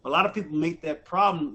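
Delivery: ordinary talk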